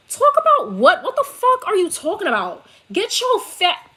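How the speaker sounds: furious New York snap, voice jumping way up; New York coming out. Real, not acted. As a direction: let the New York accent burst out in outrage. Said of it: "the New York almost came out of me".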